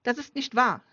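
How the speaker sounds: accused friend crying out against the father. Acted. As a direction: shout back the denial. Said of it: "rief Steven".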